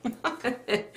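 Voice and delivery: through laughter, a high oh no; laughing. Retold or imitated. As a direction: say it while breaking up laughing. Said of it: "I'm just like".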